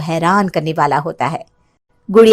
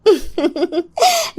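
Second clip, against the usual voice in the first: sly high giggle; 8 semitones higher, 4 dB quieter than usual. Acted. Witch laughs arriving.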